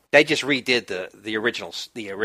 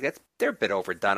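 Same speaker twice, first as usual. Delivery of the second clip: ordinary talk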